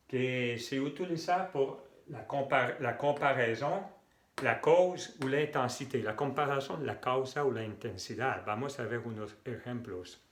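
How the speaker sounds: ordinary delivery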